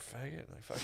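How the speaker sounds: imitated mutter under the breath; barely audible retold insult. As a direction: say it very softly, as if behind a closed window. Insult behind rolled-up car window.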